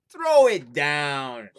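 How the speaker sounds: loud, slow, daring command; bold slow shout. Acted. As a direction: dare the ghost to throw it. Lad answers chimney.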